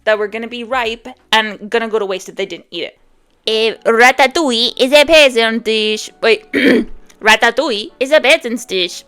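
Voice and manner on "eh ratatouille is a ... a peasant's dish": a clumsy, louder attempt at a French accent; hammed-up French accent quoting Colette; bad French accent. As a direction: try a French chef's accent and miss. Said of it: "I cannot do a French accent".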